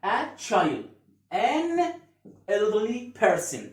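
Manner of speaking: life stages said slowly, word by word; slow review. Shown as a demonstration. Teacher slowly reviewing the life stages list. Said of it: "تاني".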